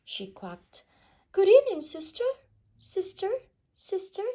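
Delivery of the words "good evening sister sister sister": very high, loud call; calling into cave. A narrator's reading. Duck calling her sister into the cave, loud and high.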